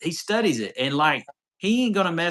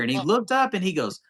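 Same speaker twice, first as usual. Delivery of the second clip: usual speaking voice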